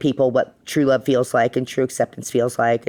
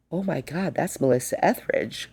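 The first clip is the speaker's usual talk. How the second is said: much softer, murmured like a buzzing crowd; 7 dB quieter than usual. Retold or imitated. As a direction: hush it like whispers spreading through a bar. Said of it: "You could hear the buzz through the crowd".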